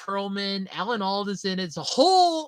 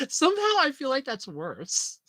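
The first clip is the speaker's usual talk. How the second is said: usual speaking voice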